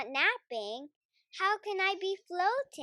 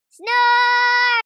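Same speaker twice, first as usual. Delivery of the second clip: much louder, higher snore; a sleeper's sudden roaring snore; booming snore; 8 semitones higher, 15 dB louder than usual. Acted. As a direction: blast one giant snore.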